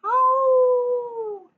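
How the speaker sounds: high loud howling like a wolf; long loud wolf howl. Shown as a demonstration. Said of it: "Can you howl like a wolf?".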